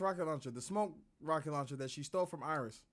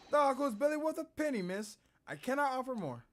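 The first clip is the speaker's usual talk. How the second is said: a hint of a higher prim shopkeeper; lightly voiced fussy pawnbroker; 8 semitones higher, 4 dB louder than usual. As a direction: politely refuse to pay more. Pawnbroker refuses the girl.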